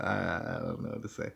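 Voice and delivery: barely audible, words sinking away; lost for words, almost to yourself; stunned mumble. Real, not acted. Host stunned by creepy video, mumbling quietly.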